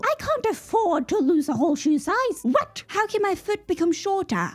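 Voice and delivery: high-pitched incredulous complaint; baffled disbelief. Real, not acted. Woman ranting about her shrinking foot, baffled. Baffled rant over lost shoe size.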